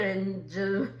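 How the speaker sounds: ordinary talk, nothing marked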